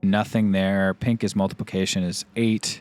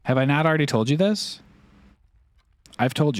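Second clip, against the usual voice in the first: higher, exasperated rhetorical question; mock exasperation; 5 semitones higher than usual. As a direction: act fed up with chat.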